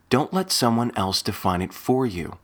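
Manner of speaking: ordinary delivery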